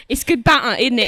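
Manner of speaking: loud colloquial British; rougher British accent. Shown as a demonstration. Guest showing a rougher voice, loud British accent. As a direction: put on a big, rough British voice. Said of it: "Little rougher".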